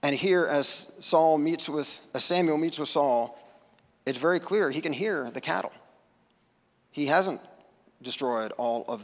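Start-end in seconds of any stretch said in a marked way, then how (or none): none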